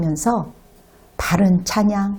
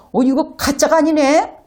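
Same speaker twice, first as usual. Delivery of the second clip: surprised realization; 6 semitones higher than usual. Retold or imitated. Son amazed by mother's prayer.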